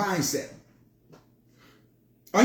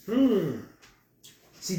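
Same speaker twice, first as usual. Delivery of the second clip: ordinary talk, nothing marked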